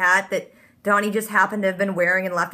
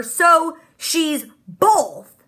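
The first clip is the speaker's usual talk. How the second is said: very slow, stretched-out words; dramatic reveal; 5 semitones higher, 3 dB louder, much slower than usual. Real, not acted. Vlogger explaining the mosaic twist, stretched-out dramatic slowness. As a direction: land the twist slowly.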